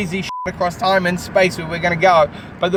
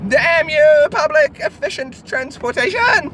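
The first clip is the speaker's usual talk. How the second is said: mock cursing; 8 semitones higher, slower than usual. Podcaster cursing a noisy bus that spoiled the audio, mock outrage. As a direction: curse the bus in hammed-up outrage.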